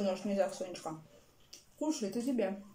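eating at the table; chewing while talking. Real, not acted. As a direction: mouth partly full. Seen 1: took forkful just before, jaw working while speaking.

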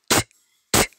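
"t" said as an unvoiced "ch"; sneezy ch sound. Shown as a demonstration. Teacher showing what C and H make together, sneezy ch. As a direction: sneeze out a short "ch" for each "t". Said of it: "you will only hear the sound".

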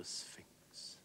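whispered single word, full of feeling; whispered salute to an old friend; hushed greeting. Acted. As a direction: breathe the name out in a whisper. Wilde's first word to his friend.

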